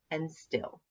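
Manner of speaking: ordinary delivery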